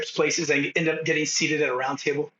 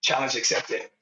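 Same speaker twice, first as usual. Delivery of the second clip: deadpan resolve; 6 semitones lower than usual. Retold or imitated. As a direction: say it like a calm vow. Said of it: "And I go".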